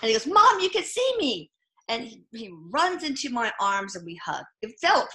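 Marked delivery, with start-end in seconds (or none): 0.3-1.5 much higher and slow, amazed; mother voicing her son's amazement; startled wonder. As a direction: say it like a child caught, half delighted. Ghost son startled she sees.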